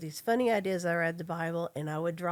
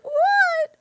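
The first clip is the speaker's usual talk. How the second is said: much higher, much louder yell; shouted what; 12 dB louder than usual. Retold or imitated. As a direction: yell it in frustration. Daughter shouting at deathbed.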